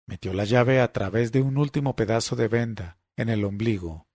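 plain reading voice

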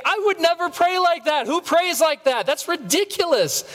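high, incredulous scoff; mock disbelief. Mocking the Pharisee to the congregation, high scoffing disbelief. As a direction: scoff like the self-righteous listener.

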